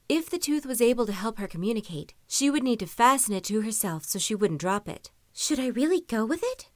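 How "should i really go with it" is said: anxious thought. A narrator's reading. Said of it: "she thought".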